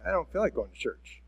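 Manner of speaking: a tired, reluctant mumble. Retold or imitated. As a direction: grumble it under your breath. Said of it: "you might have felt like".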